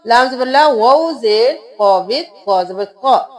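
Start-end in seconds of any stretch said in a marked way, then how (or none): none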